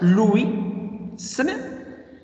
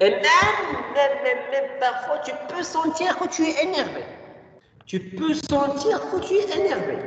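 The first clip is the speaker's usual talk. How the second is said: much higher, put-on teaching voice; high demonstrative voice; 10 semitones higher than usual. Shown as a demonstration. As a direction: pitch it right up and hold it there. Teacher demonstrates audible irritation.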